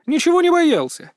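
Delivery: high-pitched defensive protest; frowning denial. A narrator's reading. Son denying his old fear to father, defensive. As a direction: deny it indignantly, pitch shooting up. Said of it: "нахмурился я".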